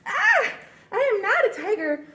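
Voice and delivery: high startled cry then laughing dismay; surprised gasp. Acted. Tiger realises he is wrong, startled. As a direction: gasp at your own mistake.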